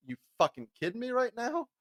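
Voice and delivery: contained mock outrage, high and soft; silent-scream look. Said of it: "it screams".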